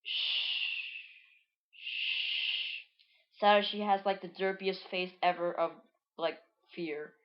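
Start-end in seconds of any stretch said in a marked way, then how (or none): 0.0-3.4 long hissed shush; two drawn-out shushes. Acted. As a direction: hush them with a long "shh". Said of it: "and she's like".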